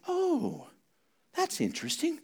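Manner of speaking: high, hushed uneasy reaction; small, high voice of an uneasy churchgoer; mock polite unease. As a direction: softly feign interest while disturbed. Acts out disturbed church folks reacting.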